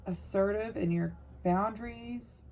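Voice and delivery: ordinary delivery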